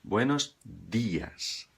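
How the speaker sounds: very slow "Buenos dias" with hard d; correct Spanish. Shown as a demonstration. Teacher repeating the correct greeting slowly. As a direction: model "dias" slowly, clean d. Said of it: "pronunciar corretamente".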